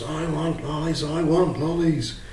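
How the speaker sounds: put-on child screaming. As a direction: whine it like a demanding little boy. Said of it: "and the boy screams".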